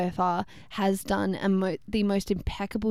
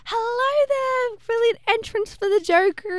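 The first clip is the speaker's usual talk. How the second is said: high Joker voice; 4 dB louder than usual. Retold or imitated. Host mimics the Joker popping from the cake in high manic voice. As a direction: leap into a manic clown voice. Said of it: "and is like".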